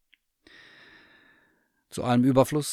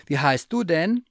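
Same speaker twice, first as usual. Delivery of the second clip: higher curious bear voice; eager teddy bear; 8 semitones higher, 6 dB louder than usual. Acted. Bear asks the cat about her wish, high and eager. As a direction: ask like a hopeful little bear.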